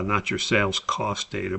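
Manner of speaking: ordinary delivery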